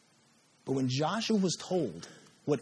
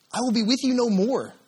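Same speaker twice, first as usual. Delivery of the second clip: loud, higher proclamation; stern declaration; 7 semitones higher, 7 dB louder than usual. Retold or imitated. God warning Joshua, loud and pitched higher. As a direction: thunder it as a warning. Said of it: "God says".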